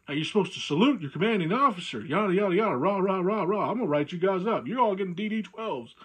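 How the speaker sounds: lightly hammed-up arrogant commander scolding; pompous officer. Mimicking the new CO scolding soldiers, mildly pompous. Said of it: "You know, stuff like that, you know, pompous, arrogant".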